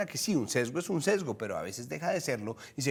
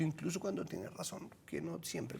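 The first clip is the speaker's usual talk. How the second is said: much softer, under-the-breath aside; quiet aside; 3 semitones higher, 10 dB quieter than usual. Real, not acted. Host slipping viewers a caveat about the president, quiet aside. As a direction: drop the volume for a sly qualification.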